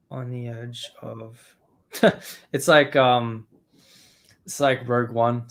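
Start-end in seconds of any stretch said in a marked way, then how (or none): none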